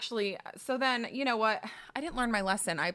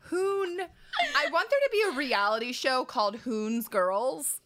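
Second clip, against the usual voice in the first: high, delighted words through laughter; laughing; 4 semitones higher, 4 dB louder than usual. Real, not acted. Comic pitches a show about Hoon, laughing. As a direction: start on a squeal, talk through the laugh.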